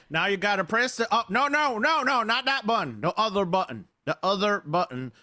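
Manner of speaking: high, frantic "no, no, no"; bossy yelling. Acted. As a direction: bark the orders in a panic. Yelling directions at a partner.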